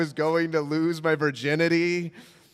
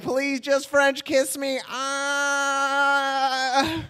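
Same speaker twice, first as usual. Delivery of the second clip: high, drawn-out comic plea ending in a wail; desperate mock begging; 8 semitones higher, much slower than usual. Smitten teen pleading with a girl, desperate mock begging. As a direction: beg slowly, pitched high.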